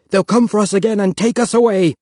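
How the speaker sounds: high, loud panic; crying out. A narrator's reading. Panicked father barricading the cabin, high loud cry. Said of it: "Papa cried".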